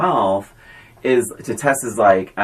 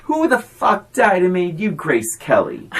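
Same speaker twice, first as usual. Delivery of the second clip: imitated furious fiancé, pitched up; mock angry tirade; 4 semitones higher than usual. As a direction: act out his indignant rant, punching the swearing. Acts out fiancé's angry retort.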